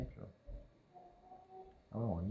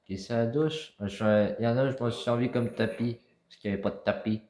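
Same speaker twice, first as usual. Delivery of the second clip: shouting to someone in another room; calling out; 3 semitones higher, 10 dB louder than usual. Real, not acted. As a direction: call it out loud across the house.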